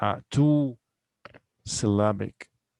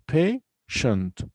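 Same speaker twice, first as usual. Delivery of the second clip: "Patient" split as pay, shent; two separate syllables; 3 semitones higher, much slower than usual. Shown as a demonstration. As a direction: count out "Patient" in two spaced beats. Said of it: "only two syllables here".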